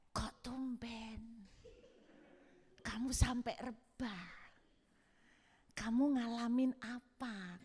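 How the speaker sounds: slow, hushed, nosy asking; hushed curious question. Retold or imitated. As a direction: lean in and ask under your breath. Quietly quizzing husband after service. (bertanya pelan dan berbisik penasaran)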